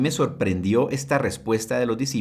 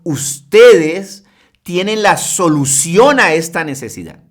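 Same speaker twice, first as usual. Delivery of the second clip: emphatic declaration; 5 semitones higher, 10 dB louder, slower than usual. Acted. Preacher voices the Lord.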